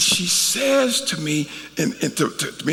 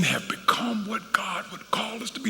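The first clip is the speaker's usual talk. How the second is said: breathy near-whisper of hope; hushed; 8 dB quieter than usual. Real, not acted. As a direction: drop to a soft whisper. Preacher drops to hushed aside.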